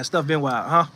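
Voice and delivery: ordinary talk, nothing marked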